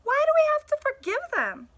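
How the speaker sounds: high, loud pleading question; exasperated dog. Acted. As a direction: push back hard, frustrated. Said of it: "I finally asked".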